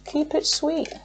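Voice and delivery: soft high murmur to Spirit; hushed plea. Real, not acted. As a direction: murmur it like a little wish over the deck. Hushed plea while shuffling.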